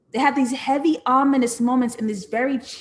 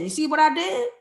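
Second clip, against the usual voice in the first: normal talking voice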